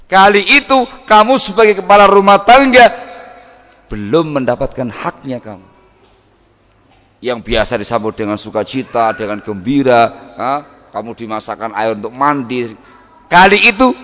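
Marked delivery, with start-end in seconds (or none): 0.1-3.1 louder, higher preaching push; raised emphasis. Acted. Preacher pressing husbands, louder and higher. 13.3-13.9 louder, higher preaching push; raised emphasis. Acted. Preacher stressing the moment to husbands, louder and higher.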